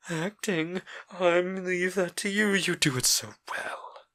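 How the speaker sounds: slow, cold sarcasm falling to a whisper; Dorian, sneering. A narrator's reading. Dorian mocking Sybil's acting, slow and sneering. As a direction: let the contempt sink in slowly.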